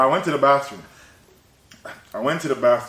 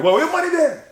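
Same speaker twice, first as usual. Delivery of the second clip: impatient shout; 13 semitones higher than usual. Retold or imitated. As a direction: push the question, annoyed.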